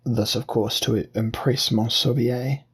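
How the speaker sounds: ordinary delivery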